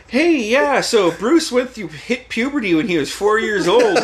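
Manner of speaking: high, loud mock-mom chatter; chatty mom impression. Mom telling the girlfriend about puberty, chatty oversharing. Mom blurts embarrassing story, voiced.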